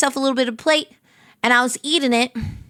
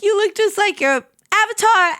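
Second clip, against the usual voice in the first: high voice; 8 semitones higher than usual. Retold or imitated. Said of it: "she was like".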